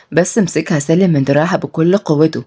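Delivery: usual speaking voice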